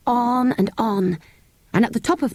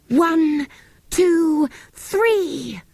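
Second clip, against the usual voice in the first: rally them for the yell; count-in; 10 semitones higher, much slower than usual. Acted.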